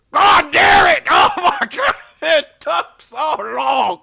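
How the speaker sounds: screaming curse, high and very loud; furious wizard yelling at the sky; furious shout. Acted. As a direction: bellow it in frustration, dragging the end. NPC cursing as he leaves.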